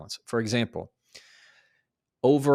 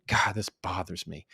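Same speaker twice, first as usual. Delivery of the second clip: muttered annoyed thought; low mock irritation; annoyed inner voice; 3 semitones lower, 3 dB quieter than usual. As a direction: grumble it under your breath. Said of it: "what they're thinking".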